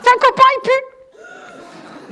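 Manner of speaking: drawn-out loud taunt; loud slow mock yell. Mum teasing the son about his smelly friend, loud and slow. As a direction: yell it out slowly to tease.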